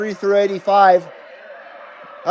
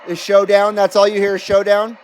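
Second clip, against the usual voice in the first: ordinary talk, nothing marked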